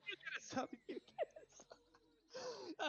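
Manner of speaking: high, slow, laughing game-show giveaway; laughing imitation. Streamer repeating the line, high and laughing. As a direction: hand out subs like a giddy host. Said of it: "echoing S1's line as a bit".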